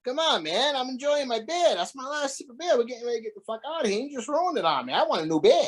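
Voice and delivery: mock indignation. Acting out Jack's outrage.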